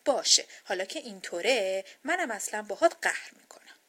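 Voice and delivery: loud huffy outburst; loud, upset. A narrator's reading. Little girl snubbing her sleeping doll, loud and upset. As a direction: raise your voice in a sulky protest. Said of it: "ناراحت شد و با صدای بلند گفت".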